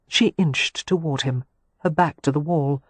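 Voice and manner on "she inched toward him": usual speaking voice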